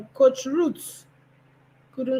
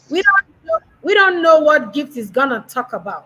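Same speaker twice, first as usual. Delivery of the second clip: playful excited teasing; 5 semitones higher, 6 dB louder than usual. Real, not acted. Host teasing as she introduces Gift.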